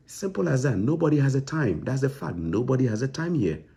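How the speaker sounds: nobody has time, rising in frustration; exasperated. Real, not acted. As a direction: throw up your hands at them. Exasperated rant continues.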